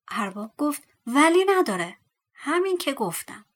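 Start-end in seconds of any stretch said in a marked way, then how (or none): 1.1-3.5 louder, higher, brook no argument; angry dismissal. A narrator's reading. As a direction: overrule him as an irritated boss. Angry master cuts off protest. (با عصبانیت و قاطعانه)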